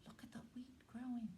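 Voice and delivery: hushed delight at the sprouting wheat; amazed whisper. Acted. Hen marvels at her growing garden, quick whisper. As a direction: whisper it fast in wonder.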